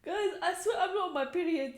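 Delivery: giggling at herself; laughing. Real, not acted. Joking about her tears.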